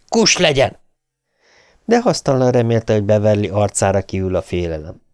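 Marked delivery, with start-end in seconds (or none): 0.1-0.8 mock yell. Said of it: "üvöltötte a férfi".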